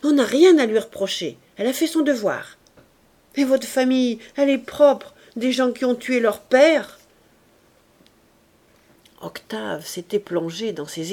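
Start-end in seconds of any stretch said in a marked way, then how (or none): 0.0-7.0 loud, heated retort at her husband; exasperated wife lashing out at her husband. A narrator's reading. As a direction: fire back, fed up at last. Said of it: "exaspérée à la fin".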